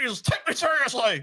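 high squeaky parody voice; mock Ben Shapiro. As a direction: squeak it out like a teen pretending. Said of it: "Look how deep my voice is".

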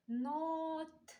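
"Нот" stretched, ending on a crisp т; drawn-out "Нот" singling out T; sound-analysis demo. Shown as a demonstration. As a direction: hold the о, then release the т in "Нот". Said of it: "звуковий аналіз".

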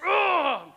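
high, loud mock "Ugh!" of disgust; wife's loud disgusted groan. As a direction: let out a big grossed-out grunt. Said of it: "it's like".

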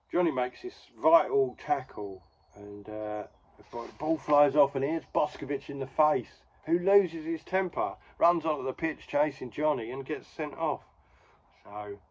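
ordinary talk, nothing marked

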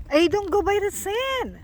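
high, slow warning call. Real, not acted. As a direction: call out across the snow. Calling out to wandering child.

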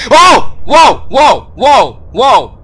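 loud high whoas fading down; startled yelping that slowly calms; scared yelps. Real, not acted. Gamer scared by a jump scare, fading yelps.

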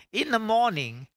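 high, loud proclaiming; hollering revival preacher. Acted. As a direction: shout the verse out. Preacher lifts the verse.

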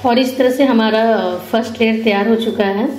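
ordinary talk, nothing marked

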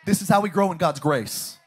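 loud, driving repeated preaching lines; full-force preaching. Acted. Pastor preaching to the church, loud and driving. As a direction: hammer each line home to the congregation.

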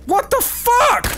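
high, drawn-out disbelief; startled, high-pitched exclamation; shocked outburst. Real, not acted. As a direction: cry it out slowly in shock. Shocked by game event.

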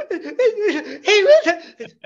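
put-on high, loud childish crying laugh; child shouting through laughter; mock yelling kids. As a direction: yell like an upset little kid. Kids yelling over mama.

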